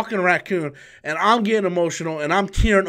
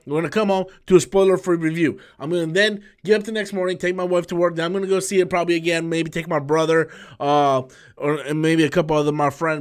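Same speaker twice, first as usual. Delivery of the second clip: usual speaking voice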